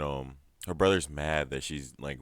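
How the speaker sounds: ordinary delivery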